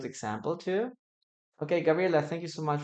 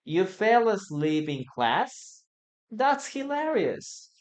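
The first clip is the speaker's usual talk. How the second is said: slow question, then a much higher amused punchline; dictation pace; 6 semitones higher, slower than usual. Shown as a demonstration. Teacher dictating the sentence for learners to write. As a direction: dictate slowly, then laugh it off up high. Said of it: "two more opportunities".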